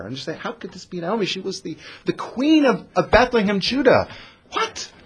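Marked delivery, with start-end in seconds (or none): 0.3-4.1 high, building sneer of fake disbelief; mocking. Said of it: "they're mocking her". 4.5-4.9 very high squeak of mock shock; a gasped, hammed-up what; women feigning astonishment. And they're shocked.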